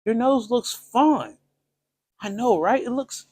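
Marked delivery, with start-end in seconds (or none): 0.0-1.4 viewer's voice. Retold or imitated. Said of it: "And you say".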